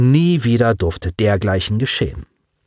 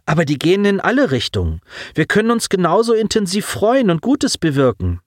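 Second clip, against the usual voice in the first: quick, higher-pitched reassurance; earnest, lifted appeal; eager reassurance; 9 semitones higher than usual. A narrator's reading. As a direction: hurry to win the listener over. Apologising, reassuring the alien.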